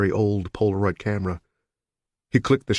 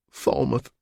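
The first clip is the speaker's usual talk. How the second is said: miserably; 5 semitones higher than usual. A narrator's reading. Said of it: "she said miserably".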